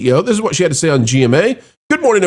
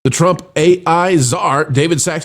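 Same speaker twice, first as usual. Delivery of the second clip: ordinary delivery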